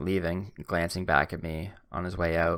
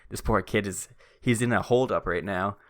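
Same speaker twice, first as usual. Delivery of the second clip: usual speaking voice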